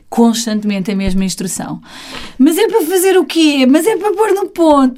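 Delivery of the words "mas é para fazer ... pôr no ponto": higher, sing-song mock child questions; lightly mocked schoolchild, pitched up; imitated pestering pupils. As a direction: pester the teacher like a confused pupil. Voicing pupils' repeated annoying questions.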